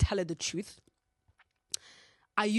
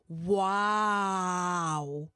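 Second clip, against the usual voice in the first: stunned wow; 4 dB louder than usual. Real, not acted. Mom found the strap-on.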